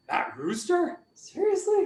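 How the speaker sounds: mildly acted disbelief, voice rising high at the end; incredulous. King staring at a talking rooster, incredulous. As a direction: question it as if you can't believe it.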